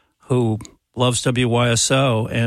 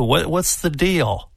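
mock-serious teasing; 6 semitones higher than usual. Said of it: "I'm just kidding".

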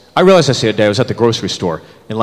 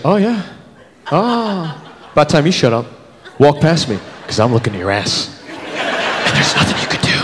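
creepy guy; 3 dB quieter than usual. Retold or imitated. Creepy street guy voiced.

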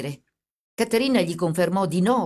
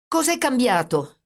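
higher, raised cry of alarm; shouted; 8 semitones higher, 4 dB louder than usual. A narrator's reading. Brother shouts at the policeman, alarmed. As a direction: call it out sharply. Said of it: "gridò Cosimo".

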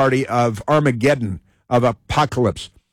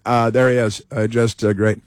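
ordinary delivery

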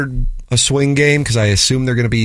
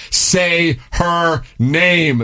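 very slow, higher-pitched, each word stretched; drawn-out proclamation; 5 semitones higher, much slower than usual. Acted. Host reading a listener's text, drawn out with mock weight. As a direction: declare it slowly, word by word.

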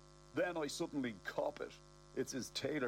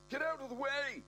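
high frantic pleading; panicked shout; 10 semitones higher than usual. Acted. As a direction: yell it while fleeing. Fleeing bomb scare.

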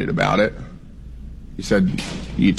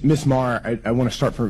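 usual speaking voice

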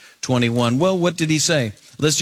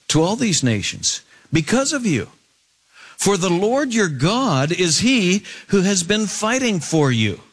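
raised-pitch scripture proclamation; impassioned preaching; 7 semitones higher than usual. Acted. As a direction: proclaim it to the gathered people, high and emphatic. Preaches Joshua's final words.

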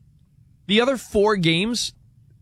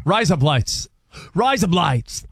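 a light mock Australian accent on "Rise up, lights" so "lights" sounds close to "blades"; slower than usual. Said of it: "in an Australian accent".

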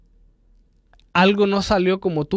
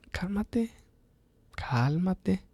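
softer, gentle soothing repeat of "Cálmate"; the Lord calming; 11 dB quieter, much slower than usual. Retold or imitated. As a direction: hush the listener down, "Cálmate, cálmate" said softly. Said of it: "Y el Señor a veces dice".

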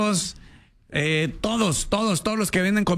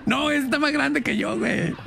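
laughing; 9 semitones higher than usual. Retold or imitated. Laughing retold quip.

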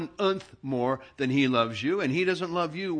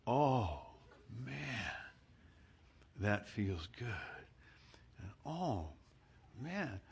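soft contented sighs; 12 dB quieter than usual. Retold or imitated. Enjoying the massage, retold.

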